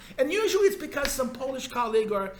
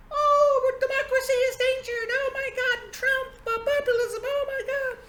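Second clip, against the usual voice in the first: very high, shrill-free squealing parody of panicked experts; mock hysterical schoolgirl; 5 dB louder than usual. Lecturer mocking panicked experts, very high hysterical squeal. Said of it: "all crying like hysterical schoolgirls about".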